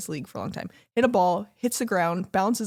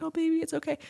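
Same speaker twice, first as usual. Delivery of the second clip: higher, tender mock soothing; comforting partner; 9 semitones higher, faster than usual. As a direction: coo it like a soothing hug. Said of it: "been like".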